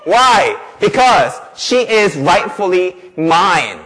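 loud, emphatic, stretched-out declaration; impassioned preaching. Real, not acted. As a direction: claim it firmly from the pulpit. Preaches at full force.

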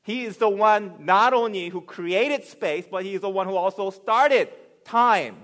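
loud, rising sermon voice; booming pulpit delivery; preaching at full force. Acted. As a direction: preach it out to the back row.

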